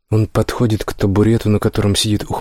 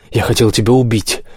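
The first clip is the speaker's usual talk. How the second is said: growled threat. A narrator's reading. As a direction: snarl the threat out. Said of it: "рычит Типерин". (рычащий, злобный голос)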